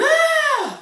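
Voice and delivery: high, loud, crazed shriek; mad scientist scream. Acted. Vlogger who loves tweaking his tests, crazed high scream. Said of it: "I'm a mad scientist".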